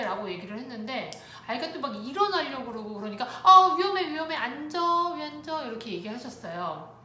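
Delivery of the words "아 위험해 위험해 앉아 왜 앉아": loud, high warning burst, easing off; re-enacted parent's urgent order; alarmed scolding. Mother to child standing up in shopping cart, alarmed scolding.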